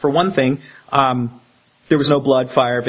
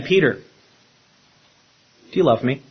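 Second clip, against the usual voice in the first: gentle, slow question; much slower than usual. Retold or imitated. Jesus asks Peter tenderly.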